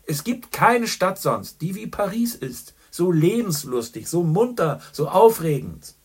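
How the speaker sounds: loud, high, jovial barroom greeting; a boisterous journalist ordering whisky for his friend; hearty old pal. A narrator's reading. Boastful Gallagher greets Chandler, loud and jovial. Gallagher raves about Paris.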